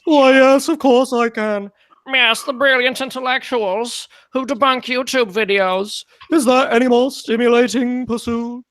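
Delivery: high, slow, put-on intellectual voice; brilliant intellectual. Streamer answering a fan's request, mock intellectual voice. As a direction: draw it out pompously as a self-satisfied brainy debunker. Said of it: "do your brilliant intellectual".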